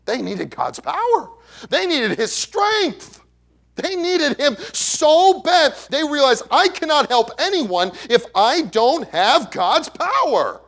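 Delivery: slow, high, loud preacher's cry; preacher pleading over the congregation; preaching at full force. Acted. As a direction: stretch each word out as the sermon peaks. Preaching climax.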